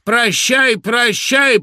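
loud, high, drawn-out cry, as if delighted; drawn-out farewell. A narrator's reading. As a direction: call it out high and stretch each goodbye. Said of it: "повторял Латкин всё с теми же поклонами". (протяжно, громко, радостно)

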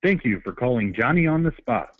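ordinary talk, nothing marked

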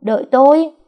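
high, loud call after him; loud high call after her husband. Acted. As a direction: shout for him to wait. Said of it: "đang lớn tiếng gọi".

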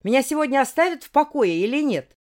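loud anguished roar; roaring like a wounded beast. Acted. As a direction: bellow it out in raw frustration. Continuing the roaring outburst.

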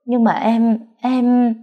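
a touch of crying, voice rising; tearful stammer. A narrator's reading. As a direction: stumble over the words near tears. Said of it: "vừa nói tôi vừa khóc to hơn".

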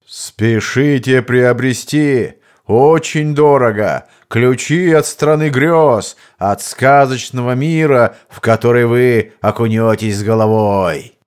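slow booming market crier; loud carnival barker. Retold or imitated. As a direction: call out to the whole market in a bass. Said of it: "зазывает народ, да ещё весьма странными возгласами".

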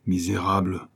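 a first choked-down insult; a hint of suppressed rage; muted outcry. A narrator's reading. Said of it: "s'écria le doux père Tantenne".

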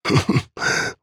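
short raspy laugh like a bark; hoarse barking laugh. Acted. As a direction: bark out a croaky old laugh. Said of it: "Хрипло, отрывисто, будто залаял".

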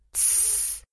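soft unvoiced shush; hushing hiss. A narrator's reading. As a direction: hush the children. Owl mother hushing children.

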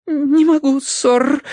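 high, frightened maid; timid babbling. A narrator's reading. Maid dares to refuse the colonel, frightened high voice. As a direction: squeak it out nervously. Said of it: "пролепетала горничная".

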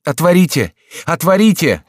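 high, loud demand; urgent repeated cry; shouting. A narrator's reading. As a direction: shout through a shut door. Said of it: "кричал он".

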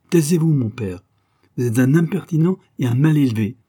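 sharp, loud and high, scolding her father; angry rebuke. Acted. Daughter rebukes father in quarrel.

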